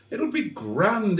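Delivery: slow high soothing reassurance; reassuring. Acted. To nervous Simone about driving, soothing reassurance. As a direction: talk her down gently, drawn out.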